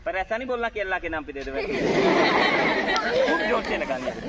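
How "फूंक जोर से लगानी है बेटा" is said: stretched call. Real, not acted. Magician urging a strong blow.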